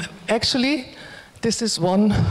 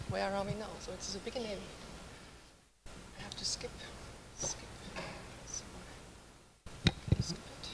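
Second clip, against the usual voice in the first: much softer, half-whispered muttering while clicking; under her breath, just for herself; soft muttered asides while skipping ahead; 22 dB quieter than usual. Real, not acted. Skipping through video, talking to self.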